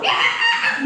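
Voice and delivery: very high-pitched acted shriek; hammed-up scream of panic; mock scream. Monkey story, acted scream.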